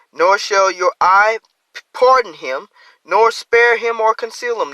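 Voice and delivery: loud, lifted reading of the verse; scripture reading. Acted. Preacher reading scripture aloud to the congregation, loud and lifted.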